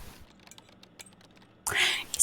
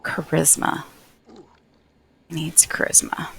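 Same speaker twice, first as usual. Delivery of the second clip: soft unvoiced whisper; 10 dB quieter than usual. Real, not acted. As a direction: whisper it to yourself. Reading game prompt aloud.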